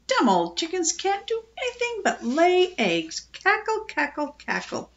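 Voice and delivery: high-pitched mocking parrot; mock the hens with a high cackle; sneering, high squawky delivery; scornful. A narrator's reading.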